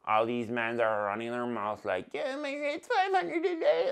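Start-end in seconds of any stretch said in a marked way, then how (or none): 2.1-3.9 high, laughing mock voice; mocking quote. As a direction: ham up the boastful guy. Mocking other videographers.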